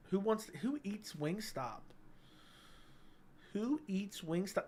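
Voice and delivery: slow, quiet disgusted scoff at the idea. Acted. Streamer to chat, disgusted by Wingstop, slow quiet scoff. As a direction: sneer at anyone who eats it, slowly and softly.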